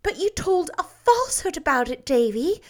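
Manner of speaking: pained, gently scolding woman; sorrowful reproach. A narrator's reading. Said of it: "more sorrowfully than ever".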